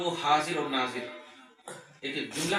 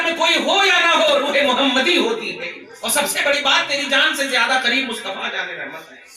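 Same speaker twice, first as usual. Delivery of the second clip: raised-voice sermon delivery; impassioned preaching; 5 semitones higher, 8 dB louder than usual. Acted. Preacher urging the congregation to greet first, impassioned preaching. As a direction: urge the congregation on with pulpit force.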